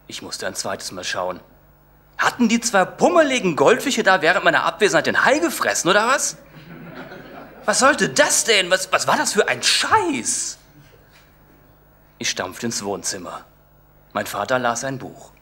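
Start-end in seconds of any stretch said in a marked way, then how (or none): 2.2-10.6 high, loud, half-laughing outrage; outraged disbelief. Acted. Boy finding goldfish instead of shark, high outrage. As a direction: sputter in shocked disbelief.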